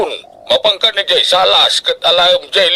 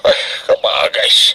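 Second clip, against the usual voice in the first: dismayed sigh; slower than usual. Acted. Father dismayed, daughter missing.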